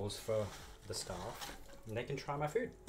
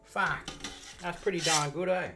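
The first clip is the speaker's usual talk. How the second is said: loud, high, hammed-up Aussie mate; very Aussie accent; 10 semitones higher, 9 dB louder, slower than usual. Coworkers praising the food, very Aussie accent. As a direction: exaggerate a broad Australian accent, much louder. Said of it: "say it with an Aussie accent".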